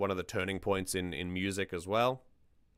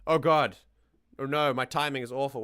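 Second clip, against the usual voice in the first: surprised; 6 semitones higher, 6 dB louder than usual. Real, not acted. Friend caught mid-bite.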